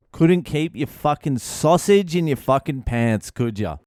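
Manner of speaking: usual speaking voice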